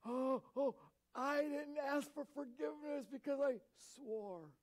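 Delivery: hammed-up wail of sudden panic; dismayed sinner. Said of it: "You're like".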